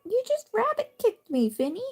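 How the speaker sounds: high playful voice, scolding the pet lightly; mock-offended chatter at the pet; pet talk. Real, not acted. Talking to the pet Finny.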